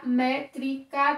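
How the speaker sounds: "métrica" slowly split into mé-tri-ca; syllable by syllable. Shown as a demonstration. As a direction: break "métrica" into three separate, drawn-out syllables. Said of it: "Vamos fazer uma divisão silábica".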